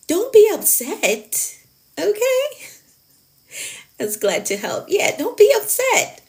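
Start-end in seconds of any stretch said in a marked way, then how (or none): 0.1-2.4 high, slow mock consoling voice; mock soothing. Mock comforting someone. 4.9-6.2 mock soothing. Mock comforting someone.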